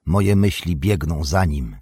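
ordinary delivery, nothing marked

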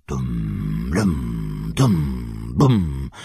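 low, deep booming drumbeats; drum boom. A narrator's reading. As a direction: voice the drums deep and heavy. Drums imitated in reading. (niski, dudniący odgłos bębnów)

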